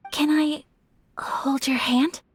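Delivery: slow, hesitant ask with a pause; tentative, drawn-out question; shy request. Acted. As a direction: ask timidly, halting midway. Shyly asks to hold hands.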